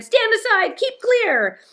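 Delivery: high, loud warning cry; urgent high-pitched shout. A narrator's reading. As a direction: yell for everyone to clear the way. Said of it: "and shouted".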